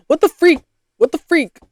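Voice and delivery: high, loud What the freak; real exasperated yelp at a search; frustrated outburst. Real, not acted. Host annoyed when Google gives wrong results, frustrated outburst. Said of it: "I'm getting a little frustrated".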